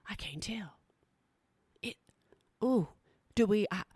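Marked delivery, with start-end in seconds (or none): 0.1-2.0 unvoiced whisper of a puzzled onlooker. Onlooker puzzling over the runner, whispered softly. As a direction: breathe it out like a confused passerby.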